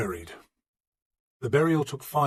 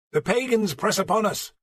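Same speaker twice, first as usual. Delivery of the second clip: loud, high proclaiming preacher; declaring; 9 semitones higher, 5 dB louder than usual. Acted. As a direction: proclaim it from the pulpit. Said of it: "Plegimund declared".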